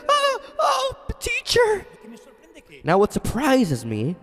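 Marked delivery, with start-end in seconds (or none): 0.1-1.9 very high, gasping mock amazement; hammed-up high-pitched astonishment; acted astonished recognition. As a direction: cry out as if suddenly recognizing someone dear. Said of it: "she said".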